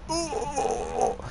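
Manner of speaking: a stabbed man's last pained grunt; dying groan. Retold or imitated. Dude impaled by Doomsday, high strangled dying groan.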